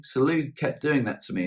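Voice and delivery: ordinary talk, nothing marked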